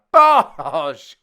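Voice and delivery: loud call breaking into laughter; laughing at his own shot; laughing shout. Real, not acted. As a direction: shout and crack up.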